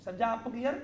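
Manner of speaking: ordinary delivery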